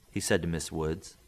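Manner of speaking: ordinary delivery, nothing marked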